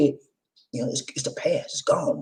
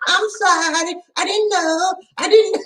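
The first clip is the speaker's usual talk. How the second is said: mock crowd reply. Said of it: "All these D in the house said".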